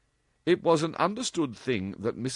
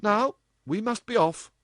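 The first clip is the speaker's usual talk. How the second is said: put-on a higher, playful young woman; lightly voiced cheeky girl; 13 semitones higher, 5 dB louder than usual. A narrator's reading. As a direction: tease her mother lightly. Dorothy voiced.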